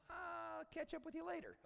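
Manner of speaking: high-pitched, put-on excuse; mock evasive brush-off. Would-be follower dodging a trip to the dump, mock evasive. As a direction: wriggle out of it in a high voice.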